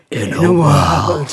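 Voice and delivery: deep, slow trailer announcer; movie trailer voice. Retold or imitated. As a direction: boom it out like a film trailer. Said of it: "where they go".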